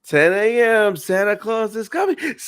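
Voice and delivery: high, giddy announcement; excited Buddy. Retold or imitated. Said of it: "And he's like".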